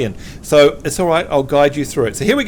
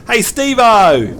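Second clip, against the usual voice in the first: drawn-out, higher Aussie call; Australian accent demo; 4 semitones higher, 4 dB louder, slower than usual. Shown as a demonstration. Host models an Aussie greeting for repeating, Australian accent. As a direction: call it slowly in broad Australian. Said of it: "make you an honorary Aussie yet".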